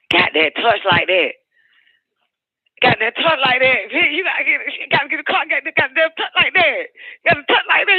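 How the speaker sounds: starts plain, climbs to a high near-sob; building to a high cry. Real, not acted. Repeating the punchline to viewers, rising to a cry. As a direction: build it up until the voice cracks.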